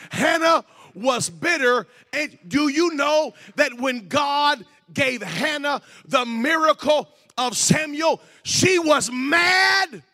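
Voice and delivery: slow, high, shouted proclamation; full-force preaching. Real, not acted. Preacher telling congregation of Hannah's miracle, full-force preaching. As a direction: stretch the words out at a shout.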